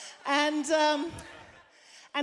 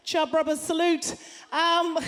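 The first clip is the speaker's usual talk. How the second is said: normal talking voice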